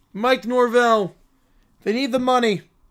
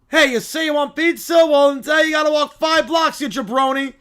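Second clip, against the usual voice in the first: loud, raised-pitch street holler; New Yorker yelling; 5 semitones higher, 7 dB louder than usual. Acted. New Yorker giving directions to visitors, brash yelling. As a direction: yell it like a brash local. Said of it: "why is everyone yelling?".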